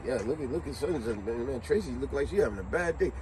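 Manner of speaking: low, soft, quick remark; gossiping aside. Retold or imitated. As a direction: pass it discreetly to a coworker. Coworkers gossiping about someone arriving.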